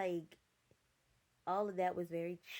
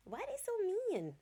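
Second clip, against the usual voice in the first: high-pitched disbelieving whine; squeaky exasperated question; incredulous squeal; 4 dB louder than usual. Real, not acted. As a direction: shoot the voice way up in disbelief. Exasperated rant about the apostles.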